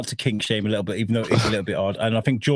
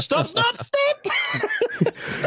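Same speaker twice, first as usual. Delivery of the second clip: very high comic squeal of stop; squealing mock stop; much slower than usual. Joking about balloon man, laughing high squeal.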